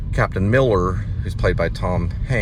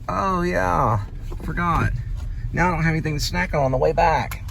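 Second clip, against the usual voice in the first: higher playful mock complaint; joking mock voice; 10 semitones higher than usual. Joking to friend about snacks, mock whine. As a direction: fake a whiny complaint, lightly. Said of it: "No, I'm just joking".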